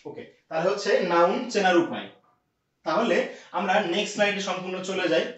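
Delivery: ordinary talk, nothing marked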